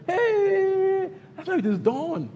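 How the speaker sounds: a high squealed Hey, then a mocking brag; a mocking impression of a show-off online; mock boastful status.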